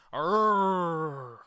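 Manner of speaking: rolled "rrrr", loud; loud mimicked growl. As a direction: imitate a hype man's growl loudly. Mimicking the clip.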